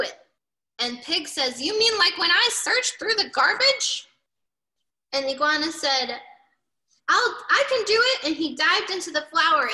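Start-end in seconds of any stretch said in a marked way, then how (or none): 1.6-4.1 high, loud pig voice; eager pig character. A narrator's reading. Reader voices Pig asking about sifting, eagerly. Pig's quote in the story. 7.1-8.2 high, loud and keen; eager volunteering. A narrator's reading. Reader voices Iguana offering to sift, eagerly.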